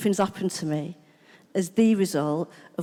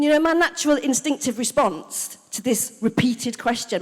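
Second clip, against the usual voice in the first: ordinary delivery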